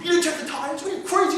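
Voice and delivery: high, loud mock scream; retold screaming. Officer screaming at his crew over the tires, high loud yell. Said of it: "He's screaming".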